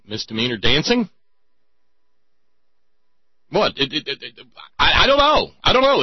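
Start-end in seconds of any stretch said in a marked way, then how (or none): 3.5-6.0 high, loud incredulous cry; exasperated disbelief. Real, not acted. Host baffled by cops arresting dancer, incredulous loud cry. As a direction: throw up your hands in disbelief.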